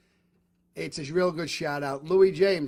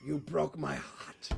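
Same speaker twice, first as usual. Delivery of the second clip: slow, soft, heartbroken mafia don; Godfather line, quiet and slow; 4 semitones lower, 10 dB quieter, slower than usual. Retold or imitated. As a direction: say it slowly and hurt, like Michael Corleone.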